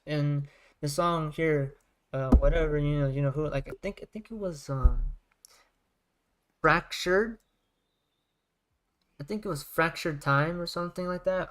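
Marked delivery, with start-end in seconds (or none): none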